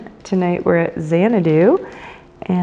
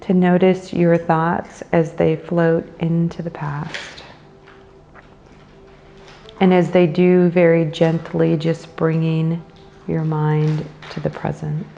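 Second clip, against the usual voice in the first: guided meditation. Acted. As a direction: guide them into the breath, unhurried and measured.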